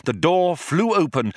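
usual speaking voice

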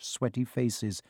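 usual speaking voice

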